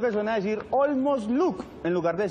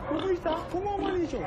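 put-on high, sweet baby-talk greeting; talking to a baby, high cooing; 11 semitones higher than usual. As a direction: coo to the baby.

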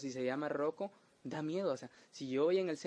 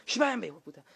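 shooing shout; 5 semitones higher, 5 dB louder, faster than usual. Retold or imitated. Shooing dog away.

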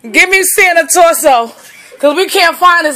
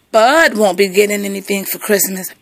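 ordinary talk

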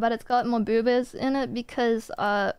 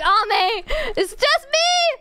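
high, slow mock insistence; retold exclaim; 13 semitones higher, 4 dB louder, much slower than usual. Telling friend Ame it's just her, high and drawn out. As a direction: exaggerate the protest. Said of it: "I was like".

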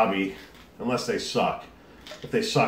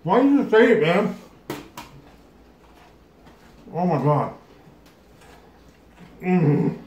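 strained, higher, louder, mouth burning; after eating wasabi; 6 semitones higher, 5 dB louder than usual. Real, not acted. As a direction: talk through a mouthful of wasabi. Wasabi heat while eating.

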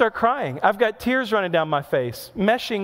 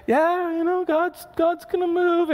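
mock hopeful; 11 semitones higher than usual. Mock hopeful self-quote.

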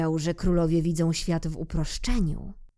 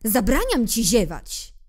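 stern king; 5 semitones higher, 5 dB louder than usual. Acted. King forbids yawning.

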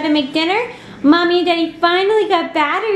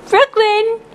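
ordinary talk, nothing marked

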